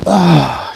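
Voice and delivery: loud effort grunt on a rep; straining grunt. Real, not acted.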